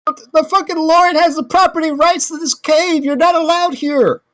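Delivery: high-pitched, worked-up protest; exasperated arguing. Acted. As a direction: argue back indignantly, voice way up. Arguing with kobolds.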